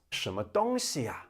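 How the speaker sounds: "什么东西啊" with a high first tone on "东"; exaggerated Beijing tones. Shown as a demonstration. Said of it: "in Beijing the tones are more pronounced".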